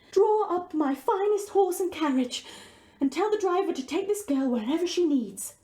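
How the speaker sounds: a prince giving a generous command; lightly voiced regal prince. Prince orders guard.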